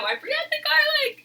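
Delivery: high-pitched nervous wail; nervous panic. Real, not acted. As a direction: squeal it in a flustered panic. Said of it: "I'm so nervous about filming this video".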